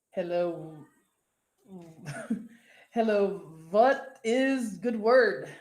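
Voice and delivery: slow, put-on Russian accent. Reading Caleb's chat message aloud, hammed-up Russian accent. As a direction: greet with a playful, drawn-out Russian accent. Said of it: "in Russian accent".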